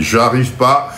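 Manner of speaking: ordinary talk, nothing marked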